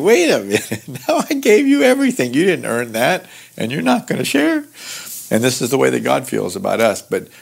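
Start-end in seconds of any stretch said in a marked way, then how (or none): 0.0-4.6 high, fast mock exasperation; exasperated parent. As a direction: play the fed-up parent who gave everything. Parent scolding ungrateful child.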